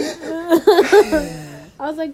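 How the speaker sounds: slow, laughing hard. Real, not acted. Friend laughing while retelling advice. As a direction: crack up mid-sentence. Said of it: "laughing heavily".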